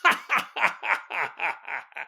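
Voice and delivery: long villainous cackle; evil cackle. Acted. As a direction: cackle like a mad old woman. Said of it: "Her laugh echoes across the country".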